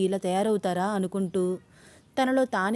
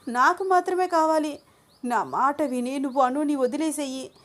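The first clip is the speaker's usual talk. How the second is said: higher, frantic obsessive voice; unhinged demand; 5 semitones higher than usual. Acted. Shweta demanding Abhi leave Anu, frantic and higher. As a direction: beg and demand like she's lost it. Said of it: "ఊగిపోతూ సైకోలాగా behave చేస్తోంది".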